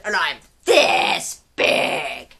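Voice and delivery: low, menacing dinosaur voice; gnashing creature. Acted. Reader voicing gnashing slashing dinosaur to Pipkin. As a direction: growl it like a big-toothed beast. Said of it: "said the creature".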